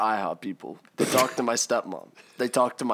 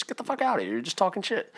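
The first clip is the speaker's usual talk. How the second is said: higher, exasperated brush-off; dismissive retort; 7 semitones higher, faster than usual. Retold or imitated. Kid retelling his retort to stepmom, dismissive. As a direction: brush her off in disbelief.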